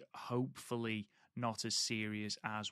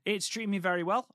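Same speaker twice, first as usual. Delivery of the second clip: enthusiastic; 8 semitones higher, 6 dB louder, much faster than usual. Real, not acted. Enthusiastic reply to friend.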